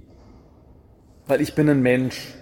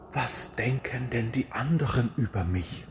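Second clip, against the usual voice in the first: much softer, a timid anxious murmur; worried inner voice; 3 semitones lower, 10 dB quieter than usual. Retold or imitated. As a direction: voice people's secret worry quietly. (leise, ängstliche innere Stimme)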